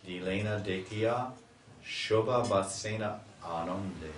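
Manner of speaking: chanted Sanskrit verse, measured and full; verse recitation. Acted. Teacher chanting a verse to his listeners. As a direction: intone the verse with devotion.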